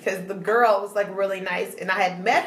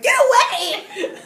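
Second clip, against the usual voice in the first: drawn-out, high-pitched mock yell; worse outburst; 13 semitones higher, 4 dB louder, slower than usual. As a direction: exaggerate the irritated brush-off, stretched out high. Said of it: "No, I was worse".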